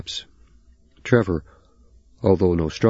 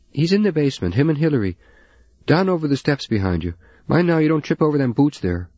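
higher, homely mother's voice; a busy mother pointing the way; Newfoundland housewife; 9 semitones higher than usual. Acted.

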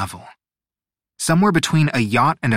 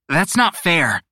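higher, indignant objection; angry protest; 6 semitones higher, 3 dB louder than usual. A narrator's reading. Ocello objecting to the chosen city, mildly angry protest. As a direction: protest with a touch of anger. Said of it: "their face all scrunched up and angry".

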